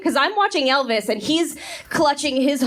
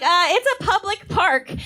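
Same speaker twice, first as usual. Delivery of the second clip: higher bratty retort; smart-aleck kid; 7 semitones higher than usual. Retold or imitated. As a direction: talk back like a know-it-all kid. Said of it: "he was like".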